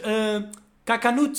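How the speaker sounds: slow, drawn-out "cacanuts" said as "ka-ka-nuts"; lightly imitated wrong word; mock mispronunciation. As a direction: stretch "cacanuts" slowly, rising at the end. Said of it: "Vira-se e diz".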